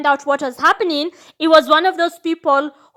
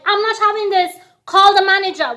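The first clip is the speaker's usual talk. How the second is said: higher, louder entitled demand; Karen; 5 semitones higher, 3 dB louder than usual. Retold or imitated. To the waitress, hammed-up Karen insisting on the manager. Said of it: "I was Karen".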